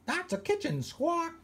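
high squawky parrot voice; be the cheeky ship's parrot. Acted. Parrot corrects the captain.

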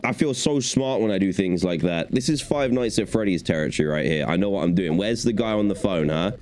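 ordinary talk

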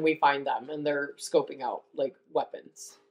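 ordinary talk, nothing marked

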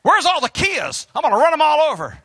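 high, fast gleeful truck voice; voicing the semi. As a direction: rush it like a truck hunting small cars. Semi voice continues.

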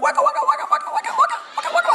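very high squeaky record-scratch noises; rapid high-pitched vocal scratching; mock DJ scratching. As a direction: imitate a DJ scratching with your mouth. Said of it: "I should have been a D J".

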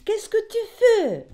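shrill surprised French question; indignant question. Acted. Teacher catching a prank in class, indignant. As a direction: catch the culprit red-handed.

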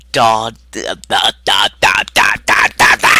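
loud staccato "da" syllables; chanted da-da-da. Acted. Vocal imitation of a rhythmic noise.

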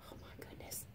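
ordinary delivery, nothing marked

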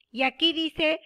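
usual speaking voice

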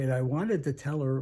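ordinary delivery

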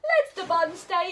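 plain reading voice

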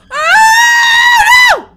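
long high loud scream; frightened scream. Real, not acted. Player reacts to a jump scare, screaming. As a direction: scream out in shock.

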